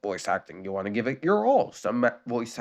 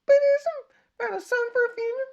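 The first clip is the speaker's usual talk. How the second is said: mock high voice. Imagined objector voice.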